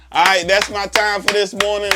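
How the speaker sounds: big loud upbeat sign-off; booming motivational speech. Real, not acted. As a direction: pump up the closing announcement.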